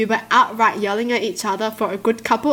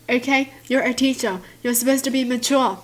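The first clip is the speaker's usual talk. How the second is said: ordinary talk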